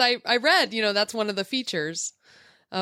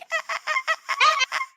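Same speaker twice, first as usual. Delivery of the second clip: high squeaky fox cackle; fox laugh imitation. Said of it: "They go like".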